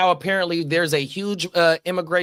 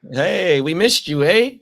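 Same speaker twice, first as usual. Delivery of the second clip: louder, cheery mock Canadian greeting; mock Canadian accent; 3 semitones higher, 6 dB louder, slower than usual. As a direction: joke along in a put-on Canadian accent. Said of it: "They don't even really talk like that".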